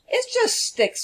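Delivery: high scoffing wolf voice. Acted. Wolf mocks Stella's stick house in a high scoff. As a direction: sneer at the flimsy house. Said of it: "said the wolf".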